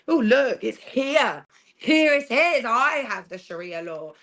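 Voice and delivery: oh look, here it is, with a thrill; excited showing off. Acted. Shows the Sharia with glee.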